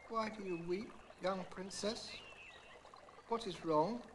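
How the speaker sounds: a small creature calling kindly; gentle frog. A narrator's reading. Frog speaks from the pool.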